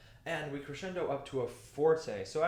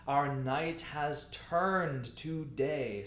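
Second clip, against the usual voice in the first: very slow, stretched "night", "turned" and "day"; stately recitation setting "night" against "day"; contrast night and day; much slower than usual. Shown as a demonstration. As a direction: draw out each key word up to "day". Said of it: "We're contrasting night".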